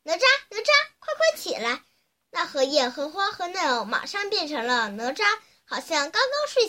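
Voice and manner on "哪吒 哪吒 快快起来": high-pitched, mildly raised summons; calling out loudly. A narrator's reading. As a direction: call the name high to rouse someone. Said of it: "大声叫". (略微放声，高声呼唤)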